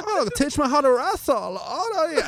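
high-pitched camp Austrian voice. Retold or imitated. Bruno flirting with wrestlers, high camp voice.

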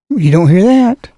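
ordinary talk

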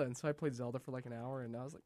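usual speaking voice

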